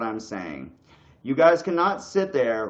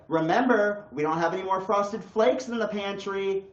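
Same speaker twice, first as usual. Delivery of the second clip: higher, chirpy reminder voice; mock reminder notification, pitched up; alarm reminder voice; 7 semitones higher than usual. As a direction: play a cheery phone alarm nagging you. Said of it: "you freaking set an alarm and it's like".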